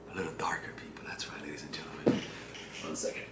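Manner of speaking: hushed unvoiced whisper in the dark. Real, not acted. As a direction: murmur low as the lights go out. Lights off, laptop died.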